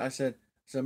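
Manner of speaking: normal talking voice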